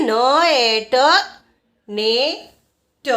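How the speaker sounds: ન, એ, ટ as separate sounds, then a very slow નેટ; sounding out. Shown as a demonstration. Teacher repeating the reading of net for learners, sounding out. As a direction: stretch નેટ after its single sounds. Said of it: "એનનું ઉચ્ચારણ".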